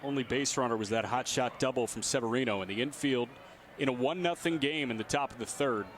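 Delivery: ordinary talk, nothing marked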